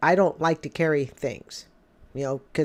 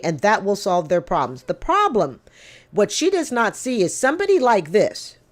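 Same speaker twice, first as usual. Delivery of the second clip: ordinary talk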